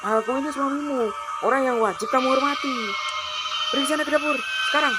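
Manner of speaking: high, loud scolding; domineering anger. Acted. Husband demanding respect from his wife, angry.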